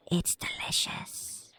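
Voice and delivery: breathy slow whisper. Acted. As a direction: whisper it like a secret. Barista whispers temptingly.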